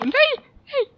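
very high, loud despairing no; anguished cry. Real, not acted. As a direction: wail as everything is lost. Falling in the game. (बहुत ऊँची, दर्द भरी चीख)

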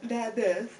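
talking through a yawn. Real, not acted. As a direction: say it mid-yawn. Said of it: "I'm yawning".

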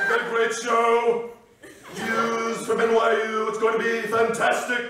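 hammed-up Trump impression, stretched words; Alec Baldwin's Donald Trump. Comedian mimicking a sketch-show president, broad caricature. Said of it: "This is Alec Baldwin's impression of Donald Trump".

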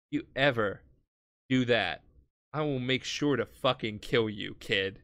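low, halting threat; quiet menace. Retold or imitated. Kakuin warning the baby never to do that, quiet menace.

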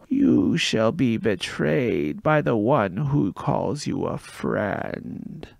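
slow, loud, eerie prophecy rasped out; raspy oracle voice. Acted. Gabe to the boy, raspy slow oracle prophecy. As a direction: intone it like a possessed card player. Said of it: "spoke in the raspy voice of the oracle".

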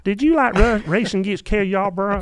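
high-pitched, thick Southern twang; mock your young self interviewing in a Southern accent; younger self's Southern accent. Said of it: "that's what it sounds like".

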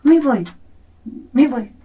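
retold shout. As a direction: shout it like she's storming out. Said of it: "me dijo".

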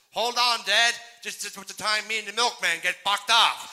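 put-on high, loud little boy yelling; little boy on his father's back, yelling high and loud; excited kid shout. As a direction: holler like a kid on a bucking ride.

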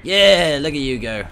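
loud, high whoop of approval; excited cheer. Real, not acted. Player cheering his teammate on as he goes, excited shout. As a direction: belt out a cheer.

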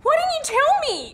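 shocked outburst. Acted. Woman shocked her friend hid her age. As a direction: cry out in disbelief.

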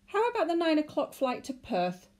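high, hopeful clerk suggestion; nervous clerk. A narrator's reading. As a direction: offer it tentatively.

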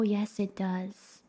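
ordinary talk, nothing marked

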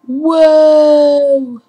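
loud, high, drawn-out whoa; surprised whoa. Real, not acted. Cheering as the install jumps ahead, loud excited whoa.

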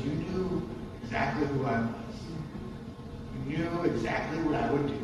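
cool, quiet blame; cold snake. Acted. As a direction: snake slithering off, blaming the boy, quiet and smug.

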